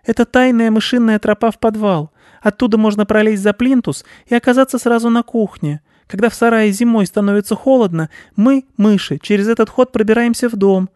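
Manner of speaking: very high, loud piping mouse; busy little mouse showing the way; squeaking mouse. A narrator's reading. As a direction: explain eagerly in a tiny squeak. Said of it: "пискнул мышь".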